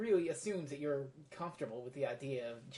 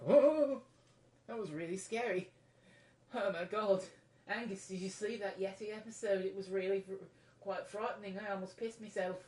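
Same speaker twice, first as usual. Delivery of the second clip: higher, excited British kid voice with a put-on accent; a loose British accent, gushing about a scary episode; vague British accent; 6 semitones higher, 4 dB louder than usual. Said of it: "what kind of accent I'm trying to pull off there".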